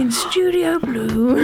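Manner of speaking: very slow nature-documentary narration; David Attenborough. Acted. Co-host narrating the studio like wildlife, very slow impression. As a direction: stretch each word out like a wildlife narrator. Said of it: "David Attenborough".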